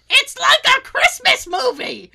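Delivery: high, loud, drawn-out mock shriek; laughing impression of a delighted shriek; imitated gleeful scream. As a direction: scream it slowly, imitating a giddy drunk boss.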